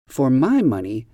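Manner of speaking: "my" given the weight, "For" and "money" light; stress on MY. Shown as a demonstration. Teacher modelling the correct stress for learners, "my" emphasized. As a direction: lean on "my" as the model phrase. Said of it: "make sure you stress the word my".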